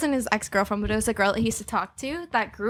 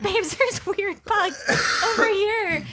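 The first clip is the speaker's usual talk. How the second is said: higher, slow dazed voice; mock dazed self; 9 semitones higher, much slower than usual. Girlfriend imitating her own spooked stoned self, slow and high. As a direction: drift through it slowly, spooked. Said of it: "I was like".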